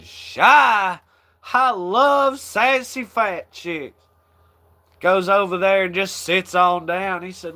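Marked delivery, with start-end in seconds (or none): 0.0-4.0 hammed-up comic flirt; quoting Larry.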